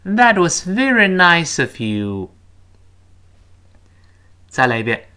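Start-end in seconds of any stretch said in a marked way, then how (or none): 0.0-2.3 slow, higher-pitched teacher demo; slow model reading. Shown as a demonstration. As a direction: read it out slowly for repeating. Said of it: "跟老师慢慢读一遍". (慢速领读示范)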